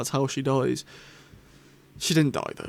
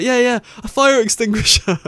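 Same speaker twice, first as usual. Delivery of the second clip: high, loud mock Jackie; chirpy hammed-up answer; imitating Jackie; 7 semitones higher, 6 dB louder than usual. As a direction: fan acting out Jackie's reply. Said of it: "she's like".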